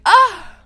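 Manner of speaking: high, loud mock-offended "Ah!"; shocked gasping cry. Arrestee hearing the officer recognize her, loud shocked cry. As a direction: re-enact a scandalized exclamation.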